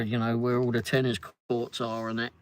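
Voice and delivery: usual speaking voice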